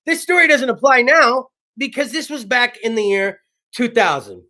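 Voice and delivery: ordinary delivery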